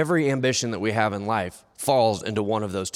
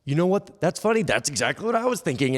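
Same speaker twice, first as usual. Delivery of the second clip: higher-pitched second voice agreeing with the first; himself answering back; 7 semitones higher than usual. Acted. Rich man replying to himself in a higher agreeing voice. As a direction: answer yourself in a raised, agreeing voice. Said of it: "he and himself have this conversation".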